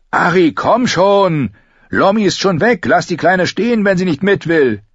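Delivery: high-pitched hurrying shout; urgent calling. Acted. Boy at the barn hurrying Arya, shouting. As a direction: yell to hurry a straggler along.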